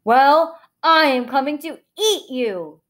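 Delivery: loud, threatening troll voice; emphatic troll menace; growling troll. A narrator's reading. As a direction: threaten your prey as a hungry troll. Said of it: "he said in a low, slow growl".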